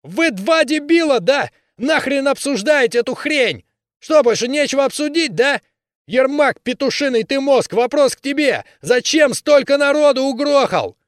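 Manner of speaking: very high, loud furious yell; berserker shouting. Acted. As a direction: rage at the two of them. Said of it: "громко крикнул".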